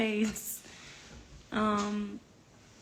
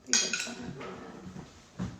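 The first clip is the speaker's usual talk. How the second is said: whisper; 9 dB quieter, much slower than usual. Real, not acted. Complaining about burnt food.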